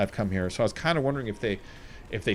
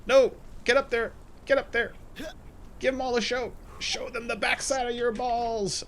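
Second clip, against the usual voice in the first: yelling; 11 semitones higher than usual. Real, not acted. Yelling at game character to climb.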